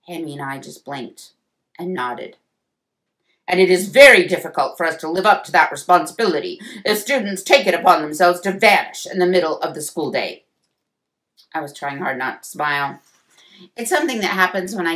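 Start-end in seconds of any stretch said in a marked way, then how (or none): 3.4-10.4 loud, emphatic scolding lecture; stern principal laying down the rules. A narrator's reading. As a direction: scold them like a strict principal. Principal scolds two pupils.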